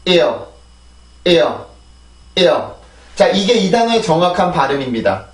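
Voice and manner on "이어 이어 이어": 이어 as one falling glide, repeated with pauses; 이어 said as a single English-style syllable; pronunciation drill. Shown as a demonstration. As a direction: model 이어 for learners, isolated and falling. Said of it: "다시 한번".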